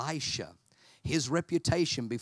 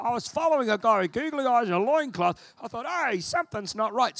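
higher, louder mock-naive voice; mock follower; 9 semitones higher, 5 dB louder than usual. Congregation member who followed the wrong guy, mock naive voice. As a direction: play the duped follower. Mock listener realising mistake.

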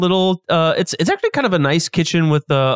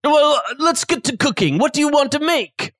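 chef character voice; 9 semitones higher than usual. Acted.

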